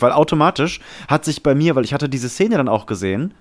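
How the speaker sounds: normal talking voice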